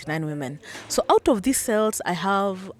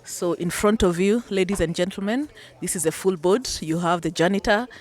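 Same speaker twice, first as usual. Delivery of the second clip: ordinary delivery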